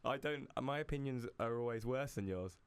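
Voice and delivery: ordinary delivery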